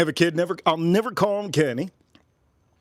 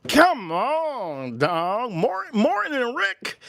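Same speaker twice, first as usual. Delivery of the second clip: high, drawn-out mock pleading; whiny put-on disbelief, stretched out high; pestering fans; 8 semitones higher, much slower than usual. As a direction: imitate an incredulous fan, pitched way up. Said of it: "a lot of people ask me all the time".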